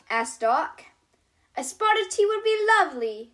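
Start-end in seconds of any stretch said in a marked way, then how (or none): 1.6-3.3 high, quick, giggly little lamb voice; a dainty giggle through the words; giggling Lammy. A narrator's reading. Lammy the lamb giggling back to Doc. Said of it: "giggles Lammy".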